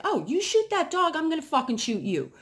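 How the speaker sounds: high, loud retold threat to the neighbor; retold furious threat. To the neighbor threatening the dog, high loud threat. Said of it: "And I was like".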